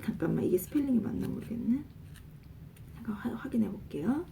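quick unvoiced whisper to herself. Real, not acted. Teacher whispering to herself while checking the spelling. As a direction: mutter it under the breath while checking.

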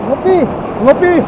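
high, rising call. Real, not acted. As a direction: call the name twice, pitched well up. Calls out a name twice.

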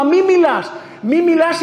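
building stern command. Retold or imitated. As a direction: command it to be silent, with a laugh. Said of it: "Είπαν στο θέλημα".

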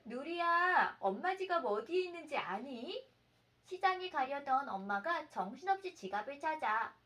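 ordinary talk

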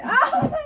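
loud, high laughing "ow"; startled, half-laughing cry; yelp of pain. Real, not acted. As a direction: yelp as something hits you. Mother hit by thrown thing.

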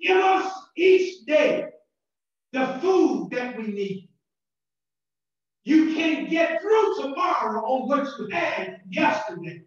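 ordinary delivery

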